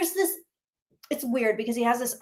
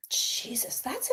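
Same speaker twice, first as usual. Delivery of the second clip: shocked whisper; 2 semitones higher, 9 dB quieter, slower than usual. Real, not acted. Reacting to shocking news.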